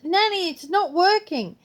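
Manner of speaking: put-on a child fussing at her nan; granddaughter voice. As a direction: granddaughter stuck in the bag, lightly voiced child. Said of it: "she goes".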